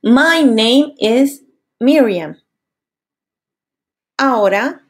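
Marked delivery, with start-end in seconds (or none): 0.0-2.4 slowed self-introduction; slow example. Shown as a demonstration. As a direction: say it slowly as an example. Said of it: "yo diría".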